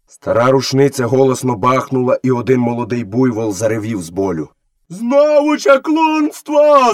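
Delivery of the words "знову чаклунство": high, drawn-out crowd cry; villagers shouting. A narrator's reading. Villagers accusing the boy of witchcraft, shouting. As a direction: cry out slowly at a high pitch. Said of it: "закричали селяни".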